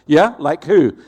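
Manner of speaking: loud, scoffing comeback; mock-cocky retort to the headmistress; cocky challenge.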